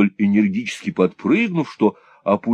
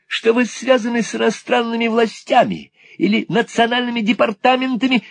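high, deferential wooing; courtly eccentric old gentleman; 14 semitones higher than usual. A narrator's reading. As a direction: apologise grandly in a high voice.

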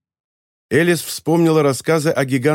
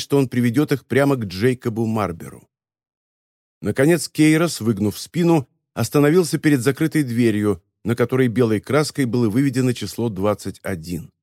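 plain reading voice

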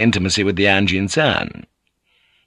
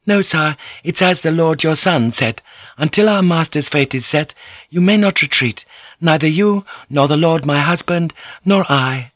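a touch higher and firm; lightly voiced young noblewoman; 8 semitones higher than usual. A narrator's reading. Mariko reminds the old lord he cannot retreat, firm. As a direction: remind him of his duty, politely.

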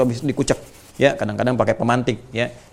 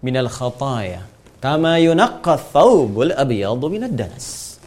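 slow Arabic recitation; 3 dB louder, slower than usual. Shown as a demonstration. Teacher reciting the prayer for his students, slow and measured. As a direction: chant the Arabic prayer slowly and evenly.

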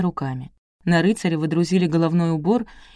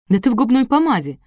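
accusing; 6 semitones higher, 4 dB louder than usual. A narrator's reading. Wife in anecdote accuses husband.

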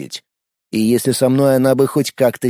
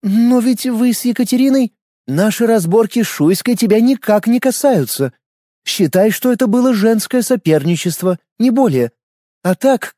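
put-on young noblewoman voice; 7 semitones higher than usual. A narrator's reading. Lena speaks to Yaroslav, Keila.

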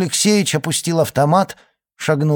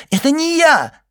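thin shout; 5 dB louder than usual. Acted. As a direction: shriek it in a thin terrified voice. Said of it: "тонко крикнул Фудин".

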